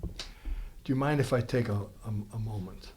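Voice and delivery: slow, hesitant, very soft asking; soft polite request. Acted. Auditioning actor asks for a moment, soft polite request. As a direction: ask gently, taking your time.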